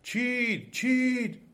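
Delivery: high, drawn cry of the trees. A narrator's reading. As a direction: call it out high, like trees raising the alarm.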